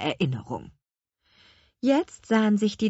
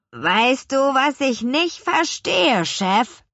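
slow, louder, raised character voice; puzzled henchman; 8 semitones higher, 5 dB louder, slower than usual. A narrator's reading. Scholli questions his boss, slow and puzzled.

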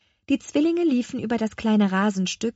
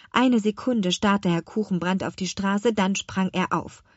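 ordinary delivery, nothing marked

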